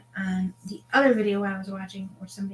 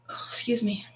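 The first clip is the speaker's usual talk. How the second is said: whispering; 4 dB quieter than usual. Real, not acted. Quiet aside while live.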